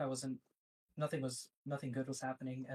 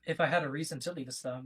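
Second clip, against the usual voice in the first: raised volume, clearly projected; speaking louder; 6 dB louder than usual. Real, not acted. Guest repeating himself for the host who asked, louder. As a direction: say it up so they can hear. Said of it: "Can you speak louder, please?".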